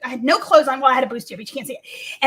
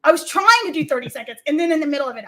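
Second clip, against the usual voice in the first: usual speaking voice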